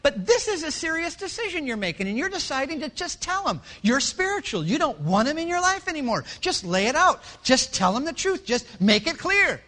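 high, insistent egging-on; the other voice. Acted. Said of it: "The other voice will say".

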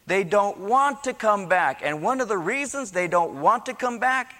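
high, driving sermon delivery; forceful preaching. Real, not acted. Preacher rebuking people too proud to turn back, forceful. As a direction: preach it hard to the congregation.

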